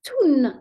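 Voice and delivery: "tun" with a hard, doubled T, punched out; teacher shows students the doubled T in "tun"; stressed doubled T. Shown as a demonstration. تُنّ بتشديد واضح. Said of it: "j'accentue plus la lettre T".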